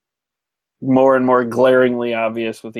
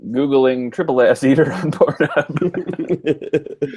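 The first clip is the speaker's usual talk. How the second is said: laughing; 3 semitones higher, slower than usual. Real, not acted. Deadpan joke, laughing.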